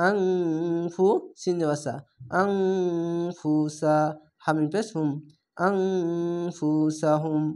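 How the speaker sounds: আনফুসাহুম chanted slowly with the ন held as a nasal hum; slow recitation of আনফুসাহুম with a held nasal ghunna. Shown as a demonstration. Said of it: "ইকফা গুন্না ... গুন্নাটি হবে".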